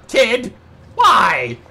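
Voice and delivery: loud outburst at the game; exasperated shout. Real, not acted. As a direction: yell it out in dismay. Exclaiming at game mishap.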